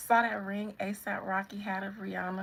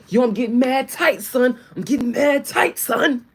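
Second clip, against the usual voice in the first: loud, higher mock-angry shouting; aggressive New Yorker; 5 semitones higher, 15 dB louder than usual. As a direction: blow up like a raging New Yorker. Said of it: "Just aggressive with".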